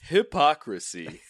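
slow, big announcer delivery; game show host. Pretend. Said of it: "I lost my game show announcer voice".

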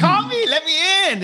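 very high, hammed-up squeal of joy; inner glee. Inner voice cheering at a job offer, very high mock squeal. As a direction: squeal it like an excited kid hiding it. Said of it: "but inside I was saying".

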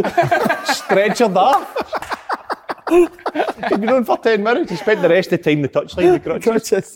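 high, loud, real laughter through the words; laughing while telling. Real, not acted. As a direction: struggle to get the punchline out through laughter. Laughing through own funny story.

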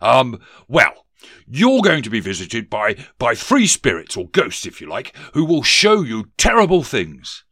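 loud, faltering, portentous warning; hedge, then boom out the threat; blustering ghost. Acted. Ghost foretells spirits to Scrooge, loud and blustering.

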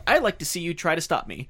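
high, loud retold challenge; defiant. Drunk husband defying the receptionist, defiant. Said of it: "he kinda has the attitude of".

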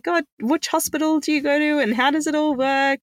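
a lightly hammed confused first-time mum; mock overwhelmed. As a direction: rattle off the questions, bewildered. Retelling her bewildered questions.

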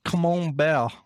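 Camembert French-style, "kuh-mohn-BEHR", silent T; French accent. Shown as a demonstration. Correcting a friend's wrong Camembert, French accent. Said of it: "I will always correct you".

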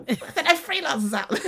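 surprised. Retold or imitated. Retelling her confused search.

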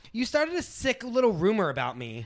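high pitched accusation; accusing. Acted. Lauren accusing Heidi of the rumor, high accusing. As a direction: nail her with it. Acted quarrel.

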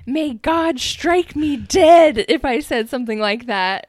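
loud, slow, overblown vow; mock solemn oath. [name] denying the comment, slow loud mock oath. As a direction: swear it to the heavens, hammed-up.

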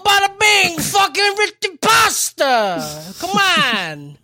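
high, loud, stretched mock New York Italian shout; over-the-top Italian mobster accent, yelling about food; hammed-up Italian mobster. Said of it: "doing the Soprano voice".

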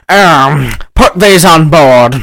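lightly put-on posh British cartoon explorer, drawn out; slow, hammed-up imitation of a British narrator; Nigel Thornberry. British narrator ordering the assistant aboard, in a hammy imitation. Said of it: "Jonathan Hyde sounds like Nigel Thornberry".

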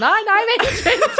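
high, loud, laughing wail of wait; panicked laughing cry. Retold or imitated. Wheeled to surgery, begging staff to wait, high laughing panic.

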